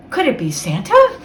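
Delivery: ordinary delivery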